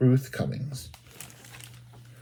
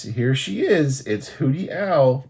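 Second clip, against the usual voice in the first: ordinary delivery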